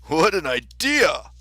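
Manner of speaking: loud, slow kingly outburst; indignant cry. A narrator's reading. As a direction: draw it out, loudly affronted. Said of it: "the king cried".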